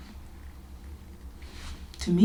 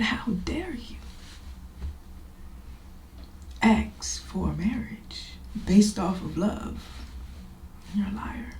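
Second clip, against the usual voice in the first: a hushed, stern rebuke; stern God voice; 3 semitones higher, 4 dB quieter than usual. Retold or imitated. God rebuking the speaker for lying, hushed and stern. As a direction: whisper it like a scolding father. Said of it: "And he said".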